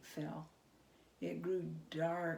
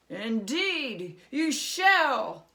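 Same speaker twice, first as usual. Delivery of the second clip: louder, rising to a boom; ominous goblin king; 5 semitones higher, 12 dB louder, much slower than usual. Acted. Goblin king ominously agreeing to be seen. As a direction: promise menace.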